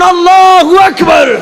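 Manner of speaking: high, slow, full-throated takbir; loud shout. Shown as a demonstration. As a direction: shout it out for all to join. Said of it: "উচ্চ সুরের চিৎকার দিয়ে বলুন". (উচ্চ সুরে টেনে চিৎকার করে আল্লাহু আকবার)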